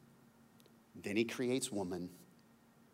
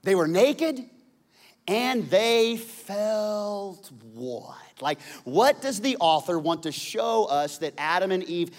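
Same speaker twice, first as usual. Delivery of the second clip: much higher and louder, building sermon shouts; full-force preaching; 12 semitones higher, 11 dB louder than usual. Acted. Pastor drives home Adam and Eve's feeling, preaching loud. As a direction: preach it out to the back row.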